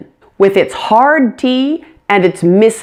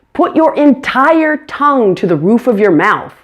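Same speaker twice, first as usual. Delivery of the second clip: usual speaking voice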